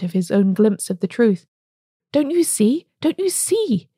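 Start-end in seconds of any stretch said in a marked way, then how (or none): 2.1-3.9 rising much higher, pleading feverishly; feverish cry. A narrator's reading. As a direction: climb higher and higher, urging them to see. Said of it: "he cried feverishly".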